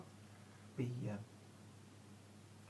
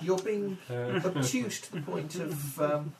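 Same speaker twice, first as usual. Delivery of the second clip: laughing, slow and loud; 8 semitones higher, 12 dB louder, much slower than usual. Acted. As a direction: laugh through the line. GM laughing in character.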